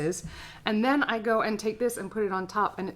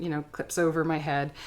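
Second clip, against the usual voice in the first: normal talking voice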